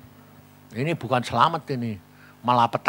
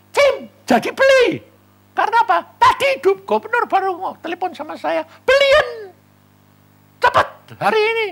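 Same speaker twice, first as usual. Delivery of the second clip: high mock voices; 8 dB louder than usual. Voicing nephew and governor.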